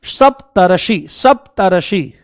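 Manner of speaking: Saptarishi said slowly, syllable by syllable; slow spelled-out word. Shown as a demonstration. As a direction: say Saptarishi slowly so the class can follow. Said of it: "हाँ, क्या बना?". (सप्तर्षि धीरे-धीरे, हर अक्षर अलग)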